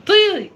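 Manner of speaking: "طي" with a heavy, emphatic T, high and loud; emphatic letter sound. Shown as a demonstration. Mother showing child how Taa is pronounced, emphatic "طي". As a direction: model "طي" with a thick T and long vowel. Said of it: "بننطق حرف الطاء والظاء ازاي؟".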